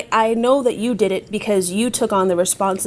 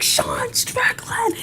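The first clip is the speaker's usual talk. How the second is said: whispered fan shout; 12 semitones higher, 4 dB quieter, much slower than usual. Retold or imitated. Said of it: "they're like".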